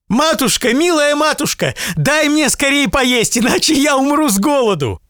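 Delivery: put-on very high child's voice, pleading; a starving boy begging his mother; hungry boy.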